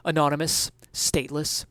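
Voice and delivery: plain reading voice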